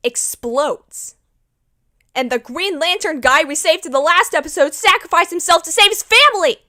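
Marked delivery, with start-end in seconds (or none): 2.1-6.6 loud, high, building to a yell; distraught outburst. Acted. Fan recapping a sacrifice to save family, distraught. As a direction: build steadily to a shout on the last word. Outraged recap of tragic death.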